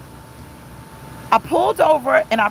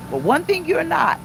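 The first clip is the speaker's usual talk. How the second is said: ordinary talk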